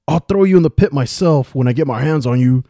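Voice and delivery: high, loud threatening shout; furious guard shouting a threat; angry yell. A narrator's reading. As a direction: yell it after someone running away. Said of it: "the guard yelled after Calvin".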